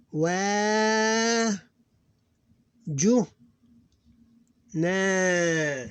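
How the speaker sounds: long vowels held high and steady; syllable-by-syllable recitation. Shown as a demonstration. Teacher reading Arabic syllables aloud, measured recitation. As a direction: chant each syllable apart, stretching long vowels.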